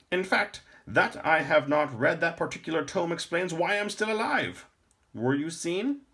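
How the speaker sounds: put-on high, loud old man's voice; a grave old lore master.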